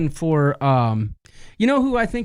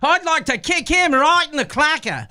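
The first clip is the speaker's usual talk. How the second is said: loud, laughing mock Australian accent; 10 semitones higher, 4 dB louder than usual. Host recalling his accent slip, loud mock Australian accent. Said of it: "Australian accent, where I'm like".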